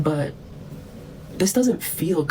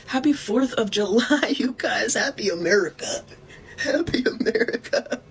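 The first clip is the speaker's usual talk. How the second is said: laughing; 6 semitones higher than usual. Real, not acted. Mocking holiday greeting, laughing.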